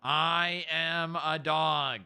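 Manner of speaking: slow, deliberate words for the dog to copy; slow model phrase. Shown as a demonstration. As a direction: spell it out slowly, word by word. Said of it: "Say it".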